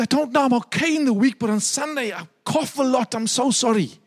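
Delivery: put-on high, apologetic old woman; lightly voiced frail old lady; apologetic elderly lady. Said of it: "She said".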